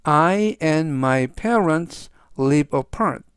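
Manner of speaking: slow, carefully spaced model sentence. Shown as a demonstration. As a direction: read it very slowly and clearly for learners. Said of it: "즉, 전체적 의미는".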